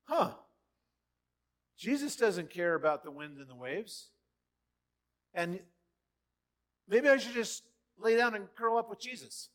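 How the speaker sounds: high light sigh of relief; lightly voiced realization; mock musing disciple. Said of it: "you would have thought".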